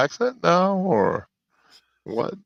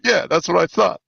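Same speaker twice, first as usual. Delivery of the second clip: retold smug agreement; 7 semitones higher, 4 dB louder than usual. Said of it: "I was like".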